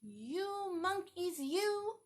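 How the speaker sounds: slow, mildly scolding peddler; understated angry peddler; mild anger. A narrator's reading. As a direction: scold the monkeys, finger wagging. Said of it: "Ooh, he's angry".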